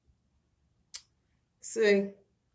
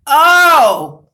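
loud high wailing oh; loud sigh; 7 semitones higher, 14 dB louder than usual. Real, not acted. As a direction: let out a big laughing oh. Exhausted after laughing fit.

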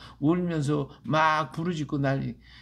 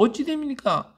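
따지는 말투; 9 semitones higher than usual. Retold or imitated. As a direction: imitate someone indignantly demanding an answer. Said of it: "따지는 거예요". (높고 크게 따져 묻는 흉내)

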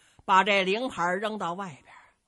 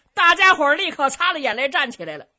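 ordinary talk, nothing marked

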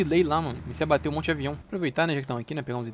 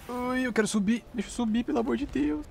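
whiny pleading; 8 semitones higher than usual. Real, not acted. Gamer begs the game to climb.

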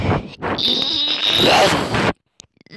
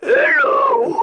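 very high, loud squeal; squeaky dog voice; 3 dB louder than usual. Pretend. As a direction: squeal a greeting like a puppy. Dog voice greeting.

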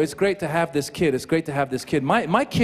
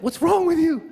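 exasperated; 11 semitones higher than usual. Retold or imitated. Exasperated dad with crying baby.

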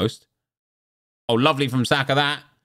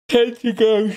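much higher and louder, said through a yawn; 13 semitones higher, 5 dB louder, slower than usual. Real, not acted. Counting down packs to viewers while yawning. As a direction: stretch the words over a yawn. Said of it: "I'm so sorry for yawning".

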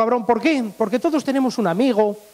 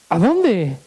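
clueless Beni; 2 semitones lower than usual. Acted. Beni misses the blonde's hint, gormlessly puzzled. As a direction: ask it like you truly have no idea. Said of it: "mi amigo el Beni".

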